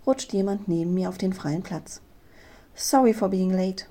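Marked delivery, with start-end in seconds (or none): 2.7-3.9 male voice. A narrator's reading. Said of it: "eine männliche Stimme neben mir sagen".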